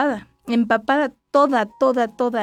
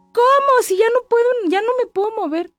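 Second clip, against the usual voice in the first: high, louder, desperate cry of exhaustion; desperate plea; 12 semitones higher, 4 dB louder than usual. Retold or imitated. Mother reliving begging the staff, high and desperate. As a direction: beg in a high strained voice, past your limit. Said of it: "tú solita impúlsate a la cama".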